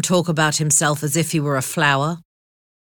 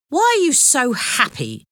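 higher-pitched suspicious question; 7 semitones higher than usual. A narrator's reading. Jailer questioning a cheerful prisoner, suspicious and higher. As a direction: question him with open distrust. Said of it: "he asked suspiciously".

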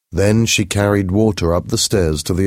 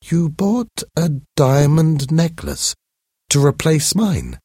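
high astonished question; as if she can't believe what she hears; surprised; 11 semitones higher than usual. Acted. To Mathilde about the replaced necklace, high astonishment.

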